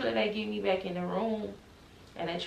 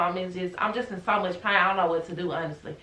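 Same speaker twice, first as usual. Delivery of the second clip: ordinary delivery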